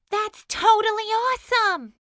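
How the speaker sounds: high loud thrill; boy excited; excited shout. A narrator's reading. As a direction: shout with delight. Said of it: "Edison shouted".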